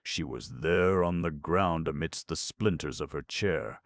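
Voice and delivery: usual speaking voice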